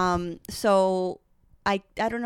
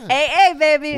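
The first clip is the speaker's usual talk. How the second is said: cheer; 7 semitones higher, 9 dB louder than usual. Real, not acted. Laughing cheer after laugh.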